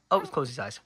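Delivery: usual speaking voice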